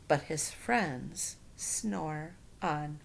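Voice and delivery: slow, soft, drowsy closing line; sleepy refrain. A narrator's reading. Ending the bedtime story for children, slow and soft. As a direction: let the story fall asleep.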